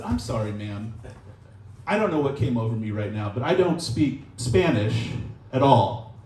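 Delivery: low, sheepish apology; apologetic. Retold or imitated. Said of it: "I had to apologize".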